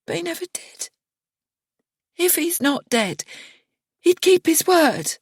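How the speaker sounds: Miriana's grief rising. A narrator's reading. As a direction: build from hushed to aching.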